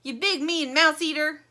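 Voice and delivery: Plastic's mock angry cry. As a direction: scold the shark. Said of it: "cries Plastic".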